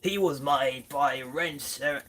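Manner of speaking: put-on a slow, failed second try at Australian accent; slow bad Australian accent. Second attempt at the toy's Australian accent, still bad. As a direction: drag out a clumsy Australian accent. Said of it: "I can't even do an Australian accent".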